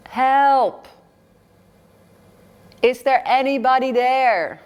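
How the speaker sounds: loud, high cries for help; desperate shouting. Acted. Pilgrim hanging from a cliff shouts for help, loud high desperate cries. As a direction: yell for help into the empty valley. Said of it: "and he's shouting".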